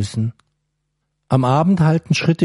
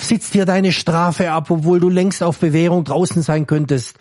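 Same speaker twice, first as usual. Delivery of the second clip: annoyed scolding; 5 semitones higher than usual. Acted. Guard to prisoner who wastes his parole chance, annoyed scolding. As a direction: scold him, still annoyed. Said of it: "schüttelte verärgert den Kopf".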